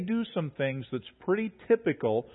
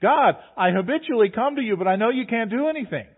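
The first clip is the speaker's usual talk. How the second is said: higher, louder mock voice of a doubting believer; higher, louder mocking of a prayer without faith; faithless prayer; 7 semitones higher, 6 dB louder than usual. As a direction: caricature a hollow, habitual prayer. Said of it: "somebody's crying out and saying".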